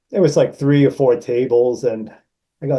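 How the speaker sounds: ordinary talk, nothing marked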